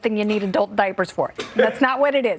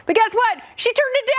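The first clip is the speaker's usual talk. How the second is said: teasing build-up; 11 semitones higher than usual. Acted. Teasing setup for a punchline.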